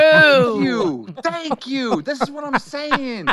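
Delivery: high, emphatic cry of agreement; triumphant, insistent thanks; vindicated. Real, not acted. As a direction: seize on the backing, pitch way up. Loudly vindicated by a co-host.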